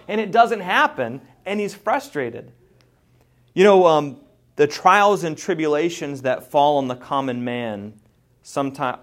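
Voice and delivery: usual speaking voice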